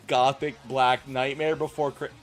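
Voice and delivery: ordinary talk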